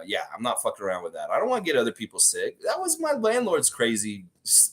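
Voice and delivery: usual speaking voice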